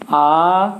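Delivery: आ held out long and slow; आ drawn out over two beats; long aa vowel. Shown as a demonstration. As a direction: stretch the vowel आ to double length. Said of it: "बोलेंगे तो हमको दो मात्रा काल का समय लगेगा".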